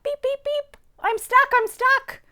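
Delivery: very high, loud distressed voice; crying little truck. A narrator's reading. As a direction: squeal it like a stuck little truck. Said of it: "cried the little blue truck".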